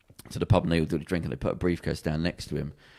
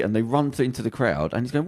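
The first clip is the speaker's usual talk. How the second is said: ordinary talk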